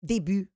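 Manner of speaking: début said French, day-bu; début in French, rounded u. Shown as a demonstration. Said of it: "That's why I say debuting".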